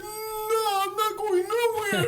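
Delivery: high, drawn-out whine; stubborn little kid. Retold or imitated. Small boy refusing to run from the angry mom, high stubborn whine. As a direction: imitate a small boy digging his heels in.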